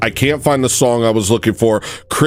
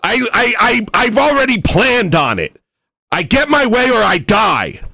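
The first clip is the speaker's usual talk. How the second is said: high-pitched manic ranting; unhinged defiance; 10 semitones higher than usual. Pretend. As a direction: rant like someone with nothing to lose. Mock-insane defiant rant.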